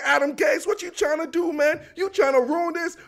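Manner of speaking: high-pitched indignant coach; Greg Williams. Retold or imitated. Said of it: "My man Greg Williams is not having it".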